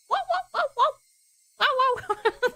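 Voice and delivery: high yelping woofs meant as quacks; high-pitched yappy woof-woof; dog bark by mistake. Pretend. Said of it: "That's a dog".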